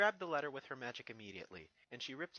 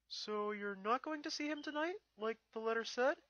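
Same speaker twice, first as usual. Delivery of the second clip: put-on high, loud young dragon voice; Spike's voice; 10 semitones higher, 4 dB louder than usual. Young dragon asks Twilight about the letter, high and eager. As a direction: play the eager little dragon, pitched up.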